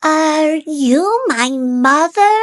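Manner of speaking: high little bird question; hopeful small chick; baby bird voice. A narrator's reading. Baby bird asks the cow, high. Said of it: "he said to the cow".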